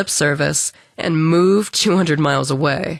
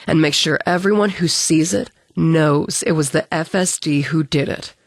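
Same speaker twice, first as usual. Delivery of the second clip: usual speaking voice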